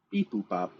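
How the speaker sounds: beep-boop robot voice. Pretend. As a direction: mechanical robot beeps. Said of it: "I don't know why I went robot".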